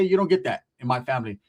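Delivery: usual speaking voice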